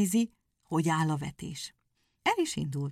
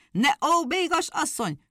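louder, sharp scolding shout; 10 semitones higher, 5 dB louder than usual. A narrator's reading. Hedgehog shouts at his wailing wife. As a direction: snap at the wife. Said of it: "rákiáltott a feleségére".

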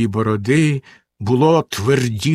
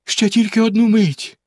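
pleading; 8 semitones higher than usual. Acted. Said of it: "благально мовила".

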